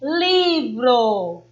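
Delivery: livro with a long, strong LI; LIII-vro, weight on the first syllable; stress on the first syllable. Shown as a demonstration. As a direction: stretch the LI in livro, then fall away. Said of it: "a sílaba tônica é a penúltima".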